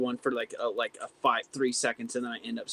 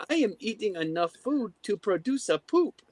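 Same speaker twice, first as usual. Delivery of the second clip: cartoon character voice; 8 semitones higher than usual. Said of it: "he's like".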